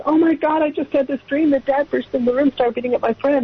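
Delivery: high-pitched, mildly breathless-with-news retelling; alarmed daughter. Retold or imitated. Daughter bursting into her parents' room with a dream, alarmed.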